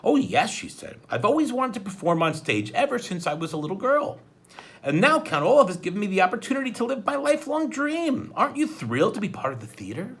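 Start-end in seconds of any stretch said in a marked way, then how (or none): 0.0-0.5 high, loud delighted reply; a starstruck judge's bright yes; lit up. Acted. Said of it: "Justice Strauss's face lit up". 1.1-10.2 much higher, dreamy thrilled voice; a stage-struck judge gushing to the kids; starstruck. Acted. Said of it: "Justice Strauss's face lit up".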